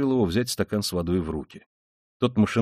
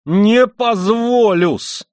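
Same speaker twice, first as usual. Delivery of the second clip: high, loud, drawn-out roar; enraged drawn-out yell; sudden shout; 12 semitones higher, 9 dB louder, much slower than usual. A narrator's reading. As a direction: shout it in fury, stretching every syllable. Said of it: "крикнул он вдруг".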